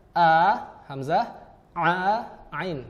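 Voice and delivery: a clean glottal "a", then "a" squeezed from the mid throat; hamzah versus ain. Shown as a demonstration. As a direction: contrast the two throat sounds. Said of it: "dari tengah tenggorokan".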